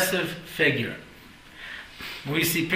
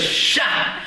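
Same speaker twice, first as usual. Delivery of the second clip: a voiceless, loud "Shah"; shushing; 3 dB louder than usual. Retold or imitated. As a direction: hush him sharply with a breathy "Shah". Brisker seems to shush him.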